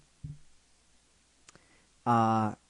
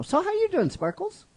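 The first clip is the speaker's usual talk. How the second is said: much higher, cooing greeting to a puppet; baby-talk hello, pitched way up; sing-song to a pet; 3 dB louder than usual. Pretend. Host coos a hello to Sparkles.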